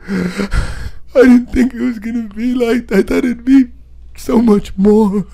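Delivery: high, slow, loud mock crying; hammed-up weepy girl voice; tearful imitation. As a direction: wail it as the disappointed girlfriend. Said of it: "she started crying".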